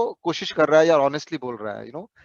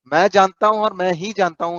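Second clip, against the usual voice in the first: louder, insistent mock boast; arrogant know-it-all; 3 semitones higher, 7 dB louder, faster than usual. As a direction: hammer home the smug I alone know. Mocking an arrogant teammate.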